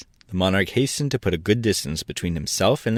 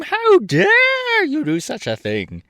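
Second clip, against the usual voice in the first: outraged king; 5 dB louder, slower than usual. A narrator's reading.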